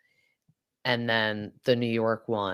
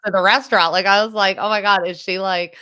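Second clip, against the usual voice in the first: shocked gossip; 7 semitones higher, 10 dB louder than usual. Real, not acted. Retelling shocked reaction to Scheana.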